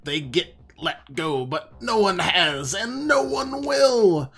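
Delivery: loud, hammed-up gang boss sneer; taunting villain voice. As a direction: boom it out like a cocky gang leader. Reading a taunting in-game sign aloud.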